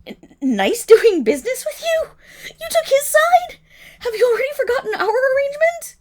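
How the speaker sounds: high, loud, near tears; shouting at the proprietor, high and close to crying; outraged disbelief. Acted. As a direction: cry out at the betrayal. Betrayed as the sale goes through.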